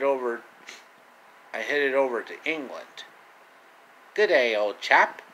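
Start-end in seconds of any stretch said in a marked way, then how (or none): 4.1-5.2 a hint of a British accent, a bit louder; mock British greeting, lightly done. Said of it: "know the British accent".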